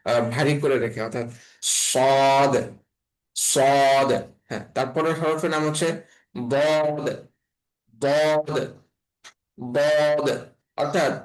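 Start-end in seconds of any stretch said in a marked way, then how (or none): 1.8-4.1 সদ said thick and held, rounded "sawd"; heavy, drawn-out সদ; heavy, full-mouthed. Shown as a demonstration. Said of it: "আওয়াজটা অনেক বেশি পরিমাণে". 6.5-10.3 বদ as a heavy "dawd", tongue edge on upper molars; thick, held "dawd" for বদ; tongue-edge articulation. Shown as a demonstration. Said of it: "আমাদের জিহ্বার".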